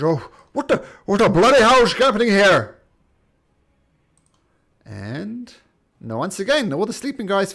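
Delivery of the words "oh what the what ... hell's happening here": startled guard shout. Acted. Woken guard bursts out.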